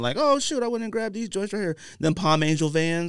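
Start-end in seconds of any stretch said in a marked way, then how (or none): 0.2-1.8 high, thrilled mock inner voice; bursting with glee over a sale find; excited. Sneakerhead recalling a sale find, excited mock thought.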